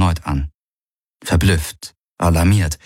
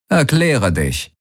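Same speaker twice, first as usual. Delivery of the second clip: higher, slow demand from a wary man; slow suspicious challenge; skeptical demand; 7 semitones higher, slower than usual. Acted. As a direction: press him for an answer, distrustful. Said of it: "musterte ihn skeptisch".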